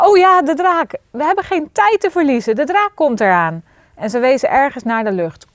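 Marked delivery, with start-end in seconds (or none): 0.0-3.6 high, loud sudden realisation; panicky, high-pitched urgency; urgent alarm. A narrator's reading. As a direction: burst out in alarm and rush on. Sudden alarm about approaching dragon.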